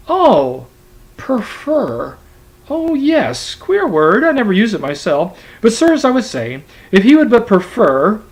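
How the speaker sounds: a fussy clerk brushing it off and pressing on; high, loud, eager servant's voice. Acted. Turkey voiced, eager to continue.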